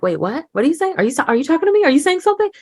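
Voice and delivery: high, rising, bewildered questions; puzzled kids. Retold or imitated. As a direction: imitate confused kids straining to catch the words. Kids puzzled by whispering parent.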